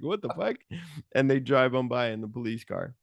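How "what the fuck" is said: high, softer what-the-fuck; pitched-up baffled exclamation; mock shock. Officer hit by thrown pants.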